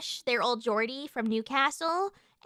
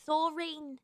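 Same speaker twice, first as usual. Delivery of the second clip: British-sounding imitation; 6 dB quieter than usual. Shown as a demonstration. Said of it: "But it's still British".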